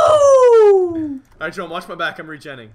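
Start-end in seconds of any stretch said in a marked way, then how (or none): 0.0-1.2 high, loud whooping cheer; excited shout. Real, not acted. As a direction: cheer it at the top of your voice. Celebrating the kill.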